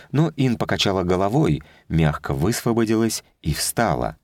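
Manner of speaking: usual speaking voice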